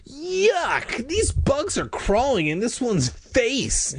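very high squeal of revulsion; high-pitched, grossed-out cousin; disgusted. A narrator's reading. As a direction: recoil in acted disgust, drawn out. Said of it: "She made a disgusted face".

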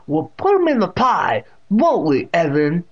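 high, loud deaf great-aunt's voice; Catherine. A narrator's reading. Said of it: "with that distinct pronunciation".

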